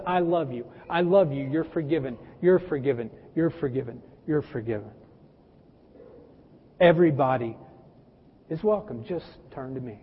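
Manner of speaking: fading to a hush, tender and forgiving; Jesus tenderly forgiving, fading to soft; tender God voice. Acted. Jesus speaking with love.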